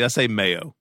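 normal talking voice

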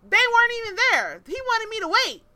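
loud, high-pitched outrage; exasperated high-pitched climax of a rant; indignant outburst. Real, not acted. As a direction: blurt out the last straw, incredulous. Indignant climax of bad-date story.

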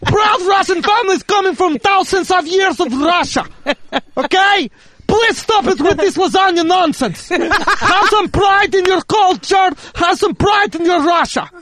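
Russian dad scolding. Pretend. Playing Russian dad scolding son.

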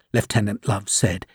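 plain reading voice

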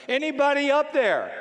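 high, loud call upward; yelling for help. Acted. Man hanging from a cliff calls for help, yelling. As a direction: yell up a cliff for anyone. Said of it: "and he's yelling".

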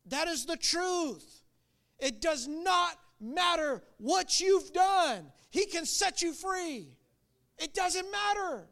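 high, emphatic sermon declaration; drawn-out, impassioned proclamation from the pulpit; fervent preaching. Real, not acted. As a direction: preach it slowly, high and insistent.

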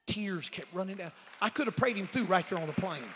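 loud, high preacher's build; loud, rising sermon cry; preaching at full force. Acted. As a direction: lift it up like a revival preacher. Preaching climax.

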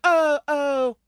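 high, hesitant mock-British royal. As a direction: hem and haw as a doddering royal. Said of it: "got the nerve to be like".

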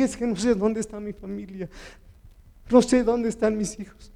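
high-pitched, distressed retold answer; retold desperation. Preacher answering the person who stopped him, desperate. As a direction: relive helpless panic in the retelling. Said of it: "corrí desesperado".

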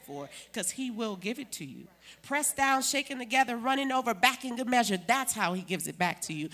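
ringing preacher's shout of scripture; loud proclaiming. Real, not acted. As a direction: call it out to the church, full force.

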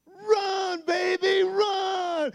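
high, loud cheering shout; mama shouting. Retold or imitated. Mama calling her son up the hill with a high shout. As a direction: yell it like a mom at the finish. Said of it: "that's my mama calling me".

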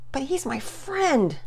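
put-on high, slow boy's defensive comeback; retorting. Boy defending his friend to his sister, high retort. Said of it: "Pearson retorted".

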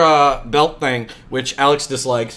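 ordinary delivery

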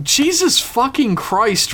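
high, drawn-out exasperation; exasperated. Real, not acted. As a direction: groan at the long email. Reacting to a huge email.

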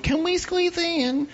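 high, slow, sweet-voiced girl; girl squeezing into elevator. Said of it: "another girl would".

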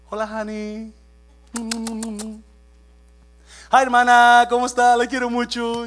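high, loud, sugary greeting; sweet new-mind voice. Pretend. Pastor acting a friendly greeting to a church sister. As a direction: gush over the sister like a changed person. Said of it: "digo, "Oh, oh, esa no es mi nueva mente".

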